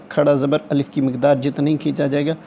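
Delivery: ordinary delivery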